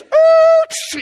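high, loud ooh; a loud mock screech; mock skid noise. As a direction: squeal like tires skidding. Skidding image.

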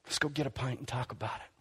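lightly playing a friend shrugging off sin; mock breezy reassurance. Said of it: "we start preaching the gospel".

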